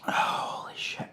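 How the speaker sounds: slow, breathless whispered curse; stunned whisper. Real, not acted. Vlogger overwhelmed by the sauce's heat whispers in awe. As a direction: whisper it slowly, overwhelmed by the burn.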